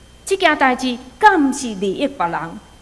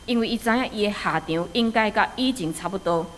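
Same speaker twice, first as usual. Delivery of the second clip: ordinary talk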